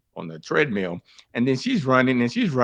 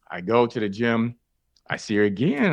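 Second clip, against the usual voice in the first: ordinary talk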